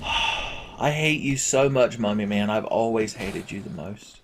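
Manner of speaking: hushed, defeated hatred; quiet loathing. Real, not acted. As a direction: drop to a soft seethe after the outburst. Seething at the enemy after yelling.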